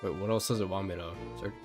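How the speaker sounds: ordinary delivery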